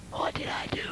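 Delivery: put-on whispered, crushed child; a child stunned by the scolding, whispered; hurt child whisper. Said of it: "And the child goes".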